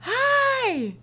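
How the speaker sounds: high bright shouted hi; high call. Retold or imitated. Runner calls to her in the street, high hello.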